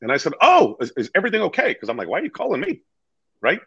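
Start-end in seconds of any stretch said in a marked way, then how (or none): 0.4-1.7 higher, louder startled check; retold alarmed reaction; startled concern. Young agent answers client's surprise call, startled. Acts his worried reply to client. 2.1-2.8 high-pitched retold bafflement; baffled question. Young agent to client calling from hospital, baffled.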